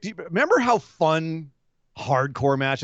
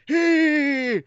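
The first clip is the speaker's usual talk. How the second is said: mock hey; 13 semitones higher than usual. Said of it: "Matt Hardy behind the wheel going".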